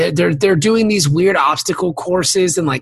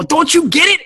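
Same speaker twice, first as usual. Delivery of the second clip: high-pitched, agitated Goodfellas wiseguy; Joe Pesci impression; 12 semitones higher than usual. Podcaster mimicking a Goodfellas actor slipping out of Southern character, high indignant impression. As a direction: push the voice up, indignant mock gangster. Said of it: "you hear Joe Pesci from Goodfellas".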